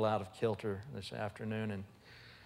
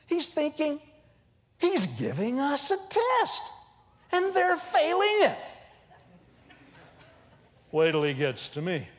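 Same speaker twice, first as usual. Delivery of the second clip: much higher, louder, fretful Peter voice; anxious Peter; 5 dB louder than usual. Retold or imitated. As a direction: play Peter nervously watching. Voicing Peter's anxious thoughts.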